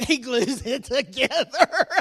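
loud, slow laughing punchline. Real, not acted. Dad cracking up at his punchline. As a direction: laugh the answer out.

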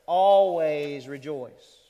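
ALWAYS drawn out slow and loud; stress on ALWAYS. Shown as a demonstration. Preacher getting believers' attention, ALWAYS emphasized. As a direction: hit ALWAYS hard and stretch it. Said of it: "puts the word always there first".

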